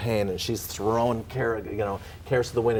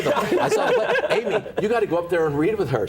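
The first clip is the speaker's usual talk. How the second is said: much higher, laughing, a bit louder; giddy laughing retelling; 10 semitones higher, 4 dB louder than usual. Real, not acted. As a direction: burst out laughing while telling.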